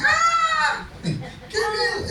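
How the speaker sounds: very high, drawn-out fake shriek; hammed-up squeal of fear; mock frightened scream. Shown as a demonstration. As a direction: demonstrate crying out in terror, playfully. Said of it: "Eso es dar voces de miedo".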